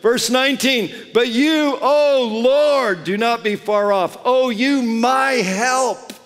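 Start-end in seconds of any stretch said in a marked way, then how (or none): 1.1-6.1 higher, louder cry to the Lord; impassioned psalm cry; fervent plea. Acted. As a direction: call out for help with full force. Crying out psalm verse.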